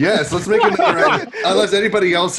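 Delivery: loud, high, laughing. Real, not acted. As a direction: say it through a laugh. Laughing agreement.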